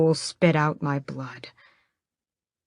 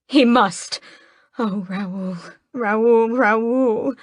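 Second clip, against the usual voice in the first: sobbing plea; 6 semitones higher, 3 dB louder, much slower than usual. A narrator's reading. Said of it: "sobs against his chest".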